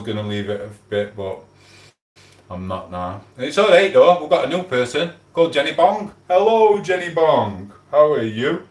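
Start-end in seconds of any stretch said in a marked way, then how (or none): 3.4-8.7 mock cheery welcome. Mock-cheery welcome to a new viewer.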